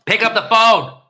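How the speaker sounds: loud, high imitation of someone demanding a call; shouted impression. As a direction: bark the order as someone else. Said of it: "who knows who I'm trying to imitate".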